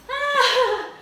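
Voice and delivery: loud, high cry of exertion; real cry of effort mid-workout; strained cry. Real, not acted. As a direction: push out the strain of the last reps. Straining cry during exercise.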